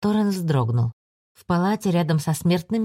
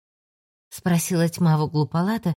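ordinary delivery

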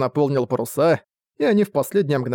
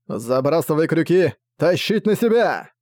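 shouted command; 2 semitones higher than usual. Acted. Filit turns to his crew, shouted boarding command. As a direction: call the orders across the deck.

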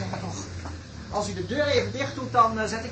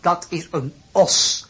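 slow explaining; 5 dB louder, slower than usual. Acted. Husband explains slowly.

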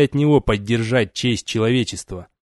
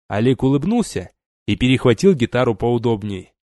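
ordinary delivery, nothing marked

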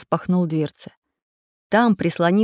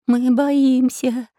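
put-on slow, higher, frightened child's voice; trembling voice; 6 semitones higher, much slower than usual. A narrator's reading. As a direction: draw it out, trembling with fear. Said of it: "дрожащим голосом проговорил Прутик".

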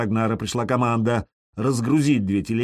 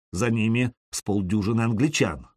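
plain reading voice

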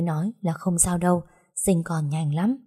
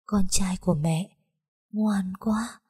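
tender grieving murmur; slower than usual. A narrator's reading. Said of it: "đau lòng nỉ non".

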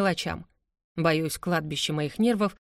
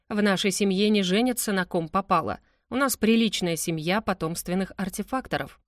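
ordinary delivery, nothing marked

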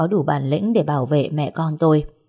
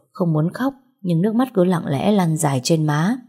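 ordinary delivery

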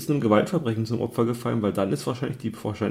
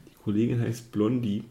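ordinary talk

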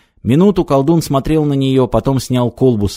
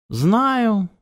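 detached, unbothered "Знаю", high and falling; indifferent; 11 semitones higher than usual. Acted. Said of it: "ответил кот равнодушно".

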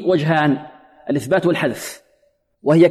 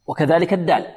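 ordinary talk

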